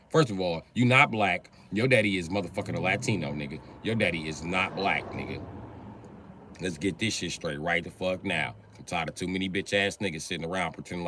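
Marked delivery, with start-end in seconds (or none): none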